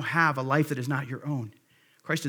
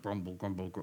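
low, much softer rumbling grumble; grumbling sound; 8 semitones lower, 11 dB quieter than usual. Shown as a demonstration. Preacher acting out the word grumble for the congregation, low rumbling grumble. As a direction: act the word out as a dull growl under the breath. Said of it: "I always love the word grumble".

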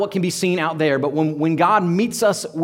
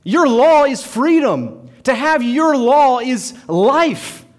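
slow proclaiming psalmist; 5 semitones higher, 5 dB louder, slower than usual. Acted. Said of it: "He says".

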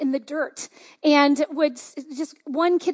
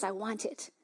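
softer, hushed first take of I want it; hushed longing; 6 semitones lower, 14 dB quieter than usual. Retold or imitated. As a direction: drop the voice, almost to yourself.

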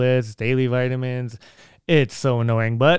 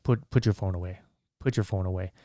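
hushed warning; 5 semitones lower, 7 dB quieter than usual. Retold or imitated. Old lady warning him quietly.

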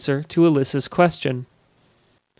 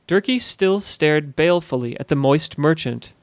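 ordinary delivery, nothing marked

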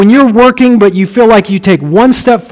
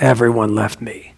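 low, subdued lament, much softer; sad; 9 semitones lower, 9 dB quieter than usual. Shown as a demonstration. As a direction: say it dejectedly, sinking low and quiet. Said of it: "it always sounded sad".

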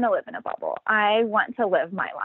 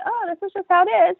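matter of fact; 10 semitones higher, faster than usual. Retold or imitated. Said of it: "very matter of fact about it".

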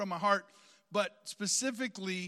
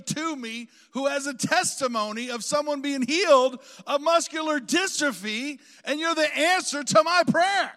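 loud, raised pitch, building to a cry; impassioned retelling; 6 semitones higher, 10 dB louder than usual. Retold or imitated. Preacher retells the lady's answered prayer, impassioned and loud. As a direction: build to a high, emphatic peak.

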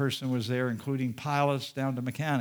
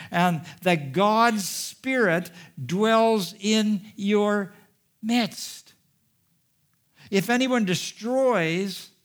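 impassioned preaching; 6 semitones higher, 4 dB louder than usual. Acted. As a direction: drive the scripture home with force. Preaching at full force.

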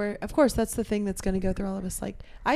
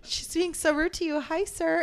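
high, bright fake-polite voice; mock sweet apology; 8 semitones higher, slower than usual. Friend apologizing to old guy in a falsely sweet voice. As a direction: put on a high apologetic nice voice.

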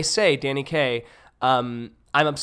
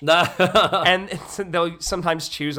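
normal talking voice

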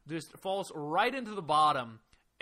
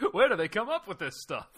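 usual speaking voice

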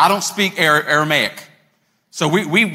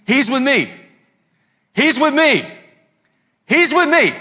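high-pitched insistent call; imitated yelling; 9 semitones higher, 3 dB louder than usual. Guide vouching for the traveler to security, calling out. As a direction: translate the yell with a raised voice. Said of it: "he's yelling out".

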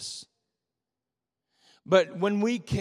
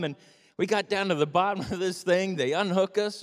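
ordinary talk, nothing marked

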